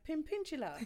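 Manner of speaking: put-on little son's babyish Pimpintula; toddler mispronunciation. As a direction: say Pimpintula like a small boy. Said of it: "he was like".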